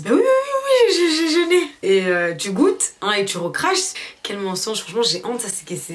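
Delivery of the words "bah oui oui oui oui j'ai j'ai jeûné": much higher, too-quick yes yes yes; over-eager fake yes. Lying to her sister about fasting, over-eager and much higher. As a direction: sell the lie too hard.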